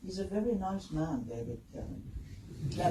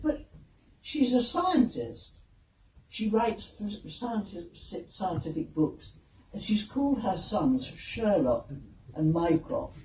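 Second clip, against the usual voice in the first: slow, emphatic mock protest; grandly reasoning, drawn-out objection; indignant protest; 3 semitones higher, 3 dB louder, slower than usual. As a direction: spell out your case slowly, word by word. Acted protest to the director.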